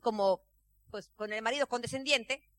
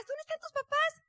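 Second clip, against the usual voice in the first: curious acquaintance. Retold or imitated. Acquaintance questions daughter.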